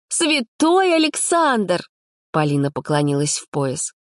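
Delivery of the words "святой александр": very high, slow mock reverence; bow to him sarcastically; high drawn-out sarcasm; sarcastic praise. A narrator's reading. Polina mock-bows.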